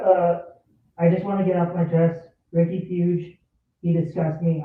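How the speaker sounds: normal talking voice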